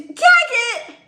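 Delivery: high, loud delighted call; thrilled greeting; excited shout. Real, not acted.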